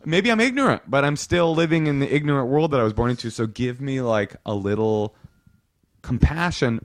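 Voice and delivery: high-pitched, pleading objection; flustered protest. Acted. Reacting to someone's pronoun correction, flustered protest. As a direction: plead for time, pitched well up.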